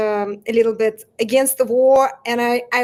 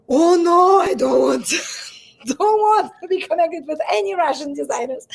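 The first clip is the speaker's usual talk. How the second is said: imitating the stylist refusing; 5 semitones higher than usual. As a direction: play the stylist backing away from Russian designers. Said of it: "certain stylists said that".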